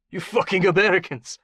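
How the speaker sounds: put-on high, accented curse; angry warlord. Beaten warlord curses his American captor, angry. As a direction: curse with bitter outrage.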